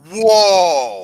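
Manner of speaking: loud, stretched "whoa" of surprise; a big retold "whoa"; surprised whoa. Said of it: "That was surprised me".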